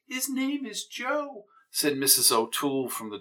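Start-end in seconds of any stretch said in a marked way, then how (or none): 0.1-1.4 neighbor's high voice. Acted. Mrs O'Toole interjecting.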